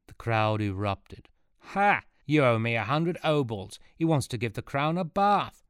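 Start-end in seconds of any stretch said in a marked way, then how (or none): 1.7-5.6 loud, higher jeering shout; mocking heckler. Heckler in the crowd jeers at Archimedes, loud and mocking. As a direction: jeer at him, triumphant.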